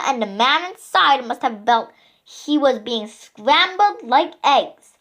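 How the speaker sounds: ordinary delivery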